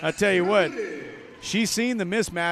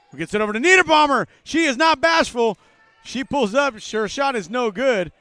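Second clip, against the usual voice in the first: higher and louder, racing through the pass, riding the play; excited call; 9 semitones higher, 9 dB louder than usual. Real, not acted. Commentator calling a bold pull-up, excited and loud. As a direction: call the drive like the crowd is on its feet.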